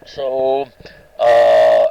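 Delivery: usual speaking voice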